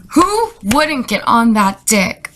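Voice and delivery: ordinary talk, nothing marked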